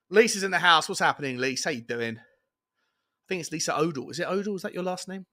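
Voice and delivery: usual speaking voice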